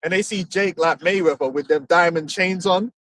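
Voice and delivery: ordinary talk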